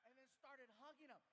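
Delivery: normal talking voice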